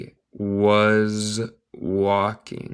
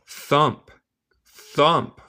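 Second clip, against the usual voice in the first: high thumping sound; 6 semitones higher than usual. Shown as a demonstration. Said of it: "teacher acts out the sound".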